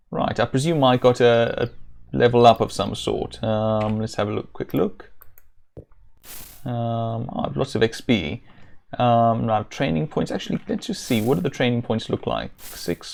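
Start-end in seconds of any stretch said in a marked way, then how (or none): none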